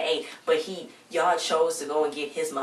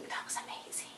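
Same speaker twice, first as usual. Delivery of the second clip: barely audible whispered praise; awed whisper; 17 dB quieter than usual. Real, not acted. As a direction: repeat it in a hushed whisper.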